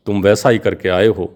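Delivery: ordinary delivery, nothing marked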